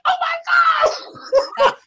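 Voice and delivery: very high, drawn-out, loud comic cry; a slow, squealed wail breaking into laughter; mock mortified wail. Student outed as teacher's paper boy, mock mortified wail. Retold horror at being named paperboy.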